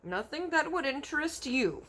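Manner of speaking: a hint of gentle giant; BFG reply. A narrator's reading. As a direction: brush him off. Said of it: "said the B F G".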